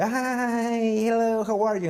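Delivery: high, bright chirpy small talk; mock cheerful American hello; fake-friendly greeting. American greeting a stranger, high chirpy fake cheer. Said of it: "struggling with the".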